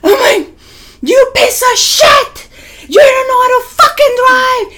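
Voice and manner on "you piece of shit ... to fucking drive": very high-pitched re-enacted road-rage scream; acted furious shriek, pitched way up. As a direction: scream insults at the other driver. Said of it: "Like, I'm screaming".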